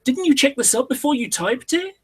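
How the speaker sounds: very high-pitched mock exasperation; retelling an exasperated comeback; incredulous retort. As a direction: voice leaps up in scolding disbelief. Mocking someone who posted without checking.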